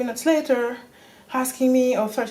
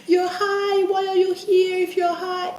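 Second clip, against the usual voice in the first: high mock yell of the staff; mocking yelling doctors; 10 semitones higher than usual. Mocking the hospital staff who yelled at her. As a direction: mimic the staff yelling accusations. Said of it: "they scream at me".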